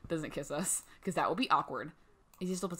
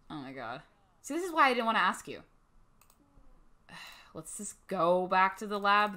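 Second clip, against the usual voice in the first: ordinary talk, nothing marked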